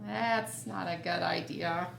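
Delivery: ordinary delivery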